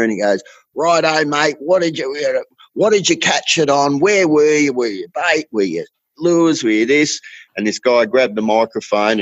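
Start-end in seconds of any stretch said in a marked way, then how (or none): 0.7-7.2 higher-pitched host firing questions; imitating event host. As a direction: quiz the winner like a stage host. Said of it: "the host, called him up".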